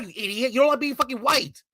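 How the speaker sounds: normal talking voice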